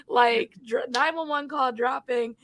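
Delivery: usual speaking voice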